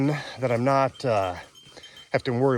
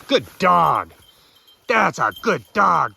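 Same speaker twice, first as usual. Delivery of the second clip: praising the dog; 5 semitones higher, 7 dB louder, slower than usual. Real, not acted.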